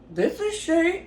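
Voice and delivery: high-pitched startled phone answer; retold surprise. Woman answering a surprise celebrity call, startled. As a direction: reenact answering the phone, taken aback.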